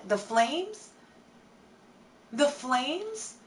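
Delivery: questioning echo with a sharp upward lift; mock disbelief. Passenger learning the car is on fire, acted disbelief. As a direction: repeat it as a dawning, incredulous question.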